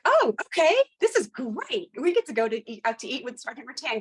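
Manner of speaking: high, eager delight; mock-thrilled yes; excited. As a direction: gush like a thrilled rookie. Excited quote of going to dinner.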